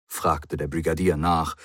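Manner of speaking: usual speaking voice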